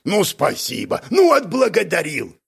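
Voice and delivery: indignant. A narrator's reading. Гнусис thanking his ungrateful companion, indignant. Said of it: "возмутился Гнусис".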